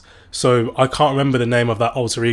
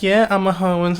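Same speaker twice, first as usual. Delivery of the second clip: higher-pitched mock rapper voice; mock female rapper; 8 semitones higher, 3 dB louder than usual. Female rappers flipping the narrative, mock rapper voice. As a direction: imitate a female rapper owning it.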